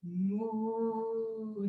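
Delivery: a soft, low mooing cow sound. Shown as a demonstration. Teacher mooing like a cow for the kids. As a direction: moo gently like a cow. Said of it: "And we're gonna say".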